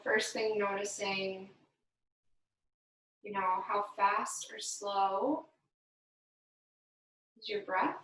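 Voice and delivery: unhurried, gentle breathing guidance; guided meditation. Acted. Yoga instructor guiding the class through breathing, calm meditation voice. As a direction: lead the class calmly through each breath.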